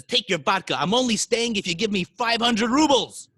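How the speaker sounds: building to a high, loud finish; defiant demand. Acted. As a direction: throw down your price loudly. Mushka makes defiant demand.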